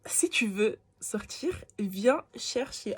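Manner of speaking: each word stretched out slowly; slow deliberate repeat. Shown as a demonstration. Retelling his taunt to the listeners, slowly and heavily. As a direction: lingers on it so it sinks in. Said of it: "repeated slowly".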